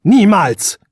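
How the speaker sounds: high, loud shouted refusal. A narrator's reading. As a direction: shout it defiantly. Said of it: "rief der Jüngling laut".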